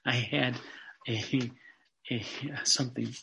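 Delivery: quiet, breaking into giggles mid-phrase; trying to talk through real laughter; laughing through words. Real, not acted. Preacher loses his thread laughing, apologizes to his listeners, giggling. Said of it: "sorry about that".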